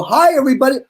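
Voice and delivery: high, projected cheery hello; mock stage greeting. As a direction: call out to a crowd, acting it out. Retold greeting to a poolside crowd.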